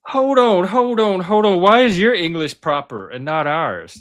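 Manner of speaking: high, loud protest cutting in; indignant interruption. Real, not acted. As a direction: break in insistently, pitch jumping up. Cuts in to challenge the AI.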